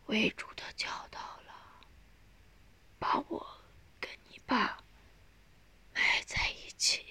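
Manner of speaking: very soft, breath-starved whisper; faint dying voice. A narrator's reading. Dying mother gives burial wish to daughter, faintly. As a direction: eke out a last wish in fragments.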